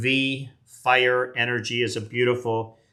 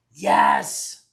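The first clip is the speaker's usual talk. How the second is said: low triumphant yes; 12 semitones lower, 3 dB louder than usual. Real, not acted. Celebrating rare pull.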